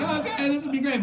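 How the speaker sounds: put-on high, hushed eager teen; hopeful high voice. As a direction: pitch it up like a hopeful kid.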